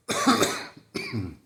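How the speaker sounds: real chesty cough; one rough cough. Real, not acted. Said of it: "I've been coughing more lately".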